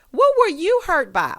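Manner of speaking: loud, high mocking question; loud sassy challenge; incredulous challenge. Real, not acted. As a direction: challenge her in disbelief. Challenging Rena's excuse.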